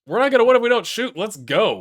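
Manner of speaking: loud, fast and high; frustrated shout. Real, not acted. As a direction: yell it at the team. Urging team, frustrated.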